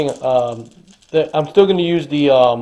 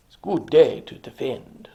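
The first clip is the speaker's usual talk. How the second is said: Hector's vow; 4 dB quieter, slower than usual. Acted. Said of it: "says Hector".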